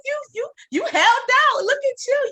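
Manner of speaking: ordinary talk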